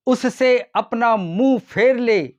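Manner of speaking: usual speaking voice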